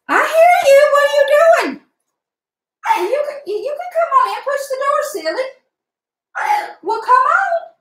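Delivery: squeaky coaxing voice to a pet at the door; pet talk. Real, not acted. Coaxing dog through the door.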